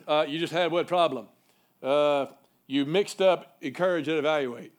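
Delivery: imitating a nasal Hattiesburg man; mock nasal old mentor; nasally Mississippi drawl. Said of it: "He kinda has this sort of nasally sort of talk like this".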